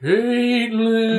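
high, drawn-out ghost voice; ghostly voice. Pretend. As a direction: call out like a ghost. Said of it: "I'm hearing a ghostly voice".

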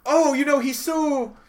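high-pitched, hammed-up delight; mock gushing, stretched out and high; gushing corporate spin. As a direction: play a honcho desperately spinning it as wonderful. Honchos gushing over his blunt refusal.

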